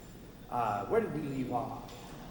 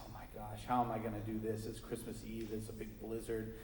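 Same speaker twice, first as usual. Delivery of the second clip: despairing sigh; 6 semitones lower, 9 dB quieter than usual. Acted. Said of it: "takes a big sigh and says".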